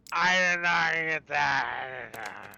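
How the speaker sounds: put-on slow, loud old man voice. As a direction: growl it out like a grumpy old man. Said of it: "You doing your old man voice?".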